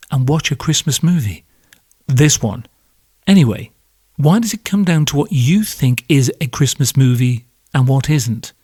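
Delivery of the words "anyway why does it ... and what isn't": ordinary talk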